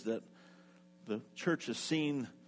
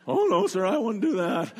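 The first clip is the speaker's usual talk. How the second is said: put-on high, loud, cracking kid's voice; a scared boy whose voice keeps breaking; nervous teen squeaking out a protest; 8 semitones higher, 8 dB louder than usual. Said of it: "voice is cracking more".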